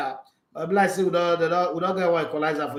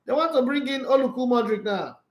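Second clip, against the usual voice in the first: ordinary talk, nothing marked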